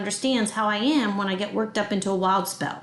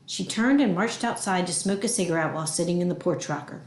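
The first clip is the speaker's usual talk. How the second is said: ordinary delivery, nothing marked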